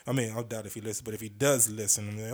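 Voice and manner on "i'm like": normal talking voice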